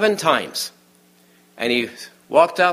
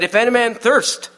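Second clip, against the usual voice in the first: high, raised preacher's cry; crying out loudly; 10 semitones higher, 4 dB louder than usual. Retold or imitated. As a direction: call it out loudly as a proclamation. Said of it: "it says he cried loudly".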